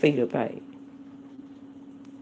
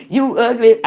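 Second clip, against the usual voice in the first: laughing; 2 semitones higher, 6 dB louder than usual. Real, not acted. Laughing delivery of phrase.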